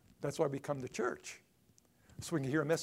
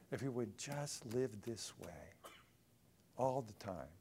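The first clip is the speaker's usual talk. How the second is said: whisper; 3 semitones lower, 5 dB quieter than usual. Real, not acted. Whispered aside.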